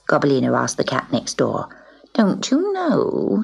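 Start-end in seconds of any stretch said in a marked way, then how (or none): 2.1-3.4 slow, high scornful drawl. Acted. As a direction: stretch it out with scorn. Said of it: "she said scornfully".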